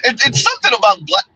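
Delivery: ordinary delivery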